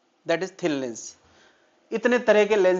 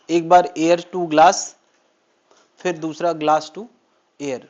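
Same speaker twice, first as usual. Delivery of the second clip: slow deliberate explaining; 3 dB louder, slower than usual. Shown as a demonstration. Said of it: "teacher spells out two refractions".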